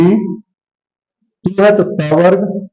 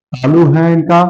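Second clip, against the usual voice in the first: ordinary talk, nothing marked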